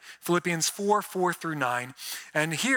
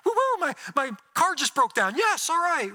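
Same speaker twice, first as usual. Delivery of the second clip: much higher, hammed-up woo-hoo over bad news; mock giddy cheer; 10 semitones higher than usual. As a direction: play a fake-happy fool cheering a broken car. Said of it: "we're gonna be all giddy about it, like".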